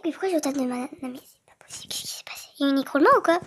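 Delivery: whispered disbelief at the game; muttered frustrated whisper under breath. Real, not acted. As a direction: hush it out, exasperated.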